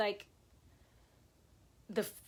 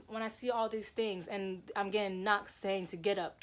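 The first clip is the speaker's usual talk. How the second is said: normal talking voice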